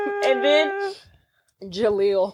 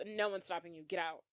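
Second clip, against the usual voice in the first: very soft, offhand retort; unbothered dismissal; 4 semitones lower, 17 dB quieter than usual. Retold or imitated. Host retelling Urkel calling the bluff, very quiet mock indifference. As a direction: drop right down, acting like you don't care. Acted quarrel, driver unbothered.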